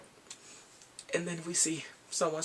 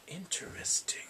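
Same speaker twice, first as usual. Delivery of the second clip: whispered interesting; a scheming, whispered interesting; sinister whisper; 3 semitones higher, 8 dB quieter, slower than usual. Retold or imitated. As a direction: breathe it with dark intrigue. He's like.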